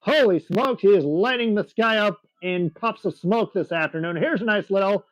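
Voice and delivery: lively live play-by-play for the crowd; air show announcer. Acted.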